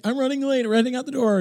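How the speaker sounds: high-pitched flustered announcement; acted-out frantic lateness; flustered rushing. Acting out frantic late departure.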